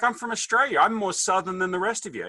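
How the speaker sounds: high-pitched playful insistence; mock protest. Explaining himself to confused Southerners, mock protest. Acted comeback to confused Americans.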